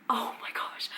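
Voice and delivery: real hushed whisper; overwhelmed whisper. Real, not acted. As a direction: whisper it, overcome with feeling. Overwhelmed by love for character.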